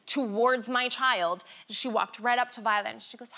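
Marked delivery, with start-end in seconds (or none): none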